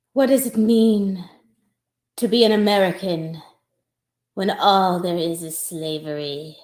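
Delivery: slow, soft, hammed-up dying musings; mock existential monologue. Actress plays a dying soliloquy, slow and soft. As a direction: muse on life in a low hush. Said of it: "I'm so out of breath".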